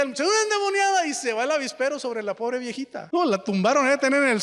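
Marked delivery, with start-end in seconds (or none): 0.0-1.1 high-pitched alarm cry; mock panicked yell; retold shout. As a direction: cry it out to the crowd. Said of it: "Y grita".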